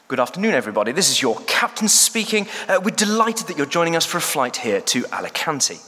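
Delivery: clipped, polished English captain announcement; posh pilot voice. Shown as a demonstration. Captain welcoming passengers aboard, in a polished English accent. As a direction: sound like a trusted home counties pilot. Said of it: "that accent fits more with what we're expecting a pilot to sound like".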